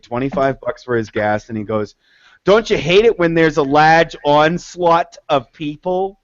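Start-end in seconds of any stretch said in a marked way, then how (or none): 2.4-6.2 imitated Massachusetts accent; big words in a broad Mass accent; thick Mass accent. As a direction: mimic a gas-station customer's thick Massachusetts accent. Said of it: "twenty-five cent words with a Mass accent".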